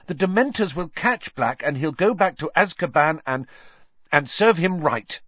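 high, loud, urgent cry; stricken Hermione. A narrator's reading. Hermione stopping Harry, stricken and high. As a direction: blurt it out in alarm.

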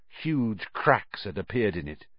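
usual speaking voice

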